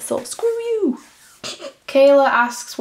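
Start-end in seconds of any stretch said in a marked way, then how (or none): 0.0-1.0 high, singsong brush-off; playful mock defiance. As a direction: toss it off high and teasing. Mock defiance about her accent.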